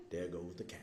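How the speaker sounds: hushed, voiceless aside; whispered punchline. Acted. Storyteller delivering the fable's punchline to the audience in a whisper. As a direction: whisper it like a secret.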